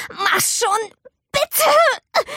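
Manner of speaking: high, strained pleading; desperate plea. A narrator's reading. Girl stuck in the window, high desperate plea. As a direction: beg under your breath.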